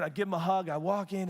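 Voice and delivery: ordinary talk, nothing marked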